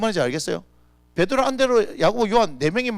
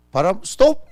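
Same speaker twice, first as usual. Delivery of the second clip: loud command; 4 semitones higher, 7 dB louder than usual. Acted. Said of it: "이게 짧습니까?".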